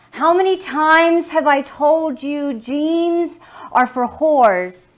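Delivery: loud, high scolding outburst; angry father. Acted. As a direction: shout it as a parent at the end of patience. Angry father voiced in poem.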